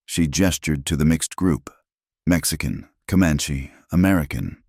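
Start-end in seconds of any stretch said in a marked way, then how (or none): none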